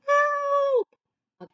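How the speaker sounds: help cried very high and loud; a high strange call for help; upside-down cry. Acted. Stick upside down in the mud, a very high loud help. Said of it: "upside down says".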